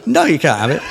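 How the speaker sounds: normal talking voice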